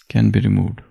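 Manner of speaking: usual speaking voice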